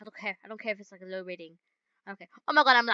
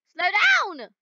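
sharp yell; 7 semitones higher, 7 dB louder than usual. Real, not acted. Yelling while driving.

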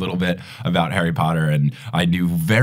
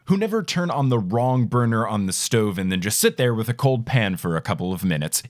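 usual speaking voice

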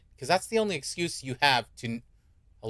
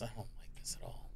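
very soft, uneasy whisper; hushed whisper; 7 semitones lower, 19 dB quieter than usual. Real, not acted. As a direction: whisper it under your breath. Creepy game scene, whispered aside.

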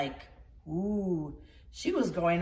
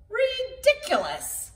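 much higher, slow cat voice; meowing cat; 12 semitones higher than usual. Acted. The cat looks down at Shelley, much higher and slow. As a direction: stretch it like a sneering cat. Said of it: "meowed the cat".

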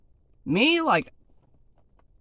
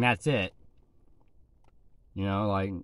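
words muffled by food; chewing while talking; 9 semitones lower, 6 dB quieter than usual. Real, not acted. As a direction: mouth partly full. Seen 2: Closed, pursed lips and chewing motion early on; mouth clears by 1.1s.

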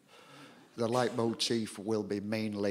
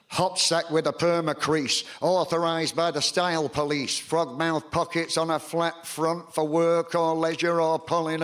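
raised, rhythmic poem delivery a bit higher and louder; poem recital; 6 semitones higher, 3 dB louder than usual. Acted. Poet performing to a live audience, declaiming rhythmically. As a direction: declaim it to the crowd with punch.

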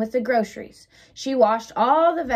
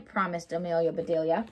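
plain reading voice

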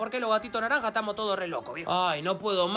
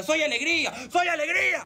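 loud, higher shout; furious insistence; 6 semitones higher, 4 dB louder than usual. Acted. Man furious at the filter, shouting. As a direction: yell it at the filter.

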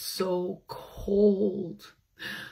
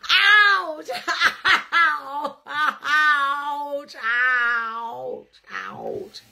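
higher ouches broken by a laugh, fading away; 6 semitones higher, 3 dB louder, much slower than usual. Acted. Storyteller playing the stung bear, laughing. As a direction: giggle through the mock yelps.

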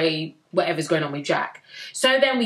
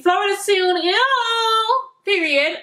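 high, fast, squealing with joy; excited squeal; 12 semitones higher, slower than usual. Real, not acted. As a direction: squeal it out, over the moon about the trip. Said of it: "I'm so excited about that".

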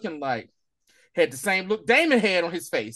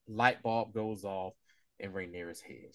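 slow hushed narration; 11 semitones lower, 9 dB quieter, slower than usual. A narrator's reading. Dramatic narration.

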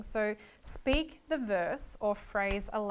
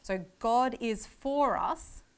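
God slow and loud; slow, weighty God is for us; stress on GOD; 2 semitones higher, 4 dB louder, slower than usual. Shown as a demonstration. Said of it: "It's he is".

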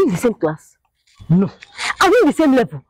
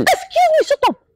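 much higher, sharp and offended; indignant outburst. Acted. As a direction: snap him silent in outrage. Cuts off suitor angrily.

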